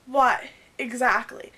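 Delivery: loud, sharp comeback; retold snap. Firing back at Patrick's "what", loud and sharp. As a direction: throw it back in his face, loud.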